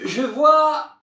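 very high, excited call; sister on lookout. Acted. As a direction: announce a sighting from afar. Sister spots something.

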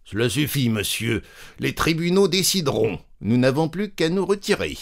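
a lightly voiced affronted gentleman; curt claimant. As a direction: cut him off, stiffly dignified. Offended claimant cutting him off.

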